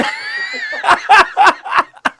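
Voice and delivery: very high squeal of laughter; laughing hard at his own line. Real, not acted. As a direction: cackle at the punchline.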